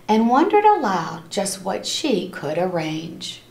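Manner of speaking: lively storybook read-aloud for a class; reading to children. A narrator's reading. Teacher reading a rhyming book to her class, lively and animated. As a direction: read it like story time, bouncing along the rhymes.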